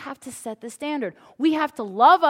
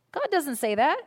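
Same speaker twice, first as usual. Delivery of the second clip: usual speaking voice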